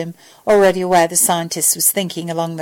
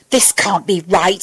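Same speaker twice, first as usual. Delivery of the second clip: plain reading voice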